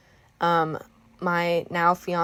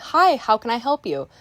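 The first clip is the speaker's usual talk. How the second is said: higher, livelier customer greeting; bubbly, lovely service voice; happy, smiley; 4 semitones higher, 4 dB louder than usual. Shown as a demonstration. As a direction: greet them brightly with a smile. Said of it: "I wasn't like".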